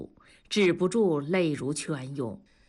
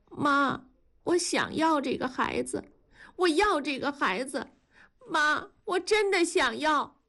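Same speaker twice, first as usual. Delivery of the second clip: pleading daughter; 8 semitones higher than usual. Acted. Daughter pleads with her mother.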